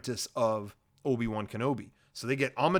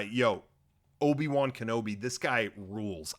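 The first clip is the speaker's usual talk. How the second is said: ordinary delivery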